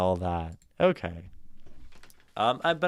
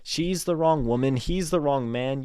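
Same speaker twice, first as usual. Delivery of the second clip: raised, higher-pitched insistent warning; urgent plea; 5 semitones higher than usual. Acted. Poem's speaker trying to stop the parents marrying, urgent plea. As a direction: plead with them, building from a single word.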